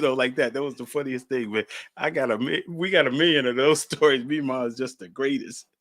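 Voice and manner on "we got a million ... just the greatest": normal talking voice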